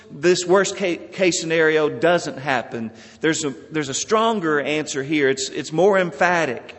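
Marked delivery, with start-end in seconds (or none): none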